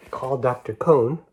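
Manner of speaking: ordinary delivery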